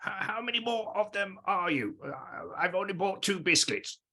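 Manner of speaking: high-pitched character voice; small character asking in a high register; Pericles. Acted. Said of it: "Pericles to say to, um, Benito".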